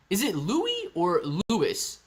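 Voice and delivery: first Louis as LOO-ee, second Louis as LOO-iss; two pronunciations compared. Shown as a demonstration. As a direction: ask it slowly, Louis said "loo-ee", then Louis said "loo-iss". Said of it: "I don't know how to say it".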